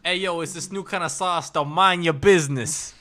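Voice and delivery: hammed-up Jersey-Italian accent; mock Jersey-Italian. Staff shutting down sauce questions, mock Jersey accent. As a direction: brush them off as a cartoon Jersey guy.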